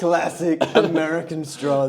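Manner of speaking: lightly voiced presidential parody; Trump. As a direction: declare it as a mock patriotic politician. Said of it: "his impressions".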